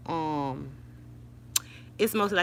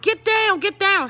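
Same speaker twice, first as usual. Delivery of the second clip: loud, high-pitched panicked command; urgent yell; 14 semitones higher, 7 dB louder than usual. Retold or imitated. Grandma to granddaughter standing amid gunfire, urgent high yell. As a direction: yell it to get her on the floor. Acted urgent order during shooting.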